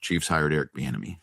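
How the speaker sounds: low, soft and quick; understated news drop. Pretend. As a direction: slip the news out quickly under your breath. Breaks news, first take.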